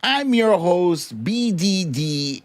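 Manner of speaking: low TV announcer introducing his own show; announcer intro. Acted. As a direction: drop into a deep radio host intro.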